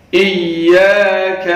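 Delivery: ایاک with a firm doubled yya, slow. Shown as a demonstration. Teacher stressing the doubled ya. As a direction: press hard on the ya in ایاک. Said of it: "سختی کے ساتھ یا کو پڑھیں گے".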